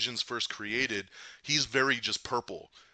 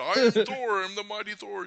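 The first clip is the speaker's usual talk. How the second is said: booming, higher mock-heroic Thor boast; 10 semitones higher, 7 dB louder than usual. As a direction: ham up Thor declaring himself, louder at first. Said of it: "Yeah, Thor".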